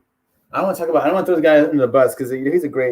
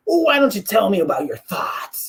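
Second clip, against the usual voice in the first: the schmo; 4 semitones higher than usual. Retold or imitated. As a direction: swoop through the question like a mock interviewer. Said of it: "He's like that".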